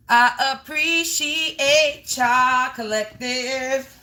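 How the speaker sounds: slow, drawn-out and sincere; heartfelt thanks. Real, not acted. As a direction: stretch out appreciate like you mean every syllable.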